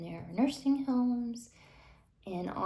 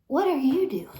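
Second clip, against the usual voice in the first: incredulous; 6 semitones higher, 6 dB louder than usual. Retold or imitated. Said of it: "slaves were looking at the natives like".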